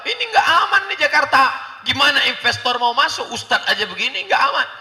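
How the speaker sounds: high, loud cry of alarm; alarmed onlookers. Acted. Onlookers worried Jakarta is unsafe, alarmed and loud.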